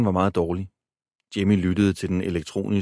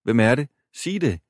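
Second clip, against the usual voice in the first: unsure; 5 semitones higher than usual. A narrator's reading. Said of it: "men hun lød usikker".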